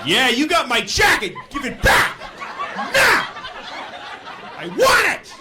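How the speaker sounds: loud, high mock-furious demands; arguing back. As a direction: escalate into yelled, separate demands. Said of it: "I'm gonna argue with this guy".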